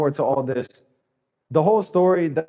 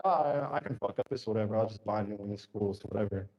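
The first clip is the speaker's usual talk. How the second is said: much softer, mumbled mock indifference; careless shrug; 6 semitones lower, 10 dB quieter than usual. Imitating a careless trader who just buys a new account, soft and dismissive. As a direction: toss it off like nothing matters.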